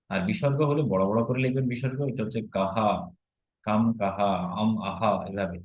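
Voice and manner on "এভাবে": usual speaking voice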